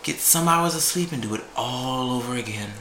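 ordinary talk, nothing marked

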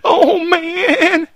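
high, loud hysterical radio caller; Area 51 caller. Acted. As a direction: wail it like a panicked alien witness. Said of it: "good impersonation of the Art Bell Area fifty-one caller".

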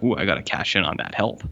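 ordinary delivery

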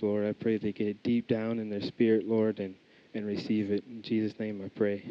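ordinary talk

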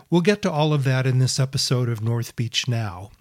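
loud, bright announcer-style podcast intro; podcast intro read. Acted. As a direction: read it like a radio show opener.

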